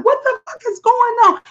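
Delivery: high, startled what the fuck; retold shocked yell; shocked outburst. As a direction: jump up high in disbelief. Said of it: "I'm like".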